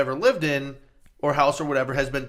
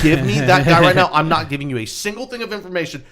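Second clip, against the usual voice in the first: much louder, higher at first, cutting the agent off; angry shout; 3 semitones higher, 9 dB louder than usual. Retold or imitated. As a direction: bark orders at the phone agent. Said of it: "He goes".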